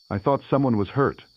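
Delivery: ordinary delivery, nothing marked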